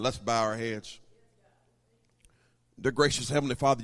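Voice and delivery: low, subdued closing prayer; pastoral prayer. Real, not acted. Pastor asks God to bless the real ones, low prayer.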